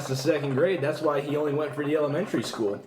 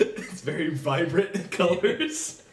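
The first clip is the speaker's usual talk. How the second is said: slow words through laughter; laughing; 5 semitones higher, slower than usual. Real, not acted. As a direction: hold back a laugh while describing. Laughing about jacket.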